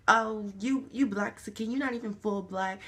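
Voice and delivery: loud, sneering mock of classmates; mean kid taunt. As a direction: throw it at him like a cruel kid.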